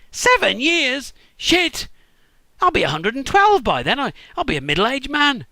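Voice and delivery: high, loud, stretched-out dismay; shocked outburst. Acted. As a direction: gasp it out in horror. Jacob shocked at seven years' work.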